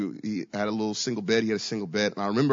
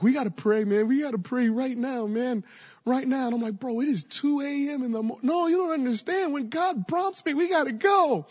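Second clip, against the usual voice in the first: much higher, fast, urgent pleading; begging your friend to go pray now; dramatic roommate; 13 semitones higher than usual. Retold or imitated. Roommate begging the narrator at 2 AM, high dramatic plea. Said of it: "and he was like".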